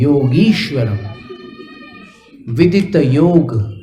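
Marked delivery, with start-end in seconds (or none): 0.0-1.0 योगीश्वरम with a long "ee", not "e"; long ee sound. Shown as a demonstration. Teacher correcting students' योगेश्वरम to योगीश्वरम. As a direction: stretch the ई in योगीश्वरम. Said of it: "योगेश्वरम नहीं".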